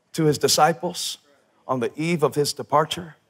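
ordinary delivery

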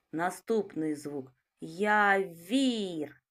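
slow, drawn-out "Явір" with the sound being counted stretched; sound-by-sound articulation. Shown as a demonstration. Teacher stretching the word for sound analysis, slow. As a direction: draw out "Явір" slowly so each sound is heard. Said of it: "Перший звук Й".

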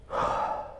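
long tired breath out; weary sigh. Real, not acted. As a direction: sigh it out, fed up. Frustrated with broken recording.

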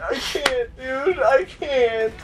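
high, whimpering complaints; whiny despair. Real, not acted. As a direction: moan like it's hopeless. Despairing at game setback.

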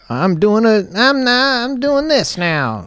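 high, slowed-down character voice; TikTok character voice. Retold or imitated. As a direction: put on a goofy TikTok character. Mimicking TikTok character creators.

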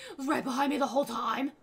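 loud laughing exclaim; laughing loudly. Real, not acted. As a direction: blurt it out while laughing. Startled, laughing at spider.

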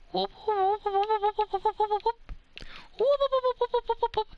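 string of high-pitched whoop noises; cartoon siren-like whoops, very high; rapid high whooping. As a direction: fire off quick falsetto whoops. Imitated noise for the move.

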